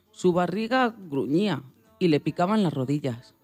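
plain reading voice

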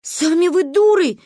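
high, loud comeback; indignant yell. A narrator's reading. Child yelling back at her friends, indignant. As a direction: hurl the insult back.

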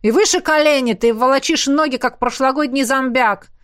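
high, loud drill instructor scolding; bellowing. Acted. Coach berating a dragging runner, bellowing. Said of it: "взревел Рурк".

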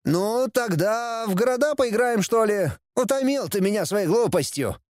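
high-pitched, insolent whining; brazen prince. A narrator's reading. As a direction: push your luck in a high voice. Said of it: "Совсем обнаглел принц". (нагло, высоким голосом)

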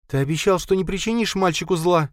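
high reproachful woman's voice. A narrator's reading. Linda reminding Khalid of his promise, reproachful. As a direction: accuse him of breaking his word.

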